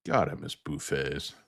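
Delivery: ordinary talk, nothing marked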